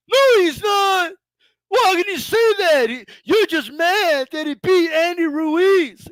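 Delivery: high, whiny fake sobbing protest; mock crying fan. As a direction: wail it like a hurt fan, hammed-up. Mock crying fan defends Joshua.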